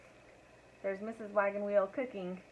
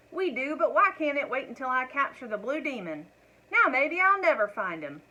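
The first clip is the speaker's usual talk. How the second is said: exasperated husband; 5 semitones higher, 7 dB louder than usual. A narrator's reading. Husband frets his hunt is ruined.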